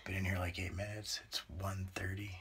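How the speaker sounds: low, hushed, half-whispered talk; very quiet. Real, not acted. Man confiding to camera in the sauna, very quiet. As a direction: keep it way down, almost whispering. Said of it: "most quiet you've ever talked".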